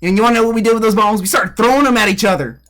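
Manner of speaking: hammed-up country Southerner; tall-tale bragging in a thick Southern accent; Southern man accent. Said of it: "I being the real Southern man I am".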